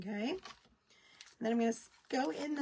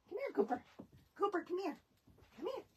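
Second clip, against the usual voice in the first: high-pitched, softer coaxing call; calling the dog; 7 semitones higher, 6 dB quieter, faster than usual. Real, not acted. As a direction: coax the dog over with a lifted voice.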